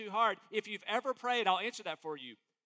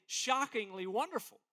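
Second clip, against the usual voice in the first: ordinary talk, nothing marked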